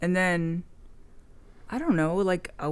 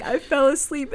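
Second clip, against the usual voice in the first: mocking friend's excuse; 7 semitones higher, 4 dB louder than usual. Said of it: "they're like".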